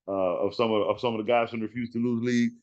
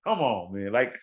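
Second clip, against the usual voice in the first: ordinary talk, nothing marked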